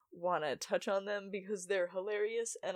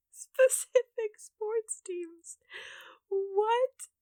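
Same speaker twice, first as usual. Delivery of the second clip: breathy laugh-squeal, high and loud; squeaky laughing whisper; 12 semitones higher, 7 dB louder, much slower than usual. Real, not acted. Laughing at her own silly child logic, squeaky whisper.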